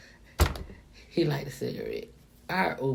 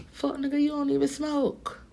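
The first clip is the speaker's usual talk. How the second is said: high, mock-incredulous call-out; higher disbelieving jab at a friend; 14 semitones higher than usual. Friend teased for not smoking, high incredulous voice. Retelling telling friend he doesn't smoke.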